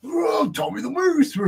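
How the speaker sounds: slow, high, shouted mock call; mocking catchphrase. Mocking rival's gimmick with a slow high shout. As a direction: drag out the name like a hammed-up ring announcer. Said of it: "That's what he does".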